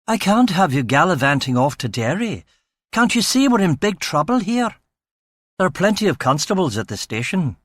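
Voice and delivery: lilting Edinburgh voice, mildly exasperated then hesitant; lightly voiced out-of-his-depth Scottish officer; fretful Scottish chief inspector. A narrator's reading. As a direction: play a flustered Scottish police boss.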